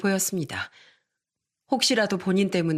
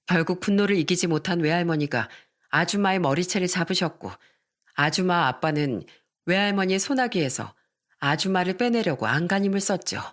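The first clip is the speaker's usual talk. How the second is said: usual speaking voice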